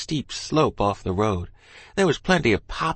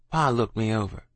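ordinary delivery

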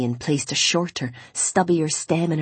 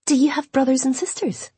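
lightly voiced higher woman's question; inquisitive Imogen; 8 semitones higher than usual. A narrator's reading. Imogen asks Owen about his family, lightly voiced and curious. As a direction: lift the pitch for a curious heroine.

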